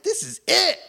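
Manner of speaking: a giddy "this is it"; excited. Retold or imitated. Pastor sure he has the job, thrilled and high.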